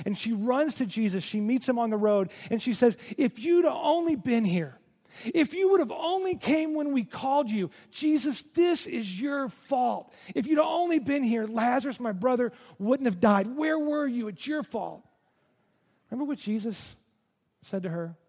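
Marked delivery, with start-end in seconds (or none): 3.2-15.1 higher, pleading, blaming cry; grieving angry accusation. Retold or imitated. Martha accusing Jesus over her brother's death, upset. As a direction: let her hurt spill out at Jesus. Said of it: "Martha's sad. She's mad".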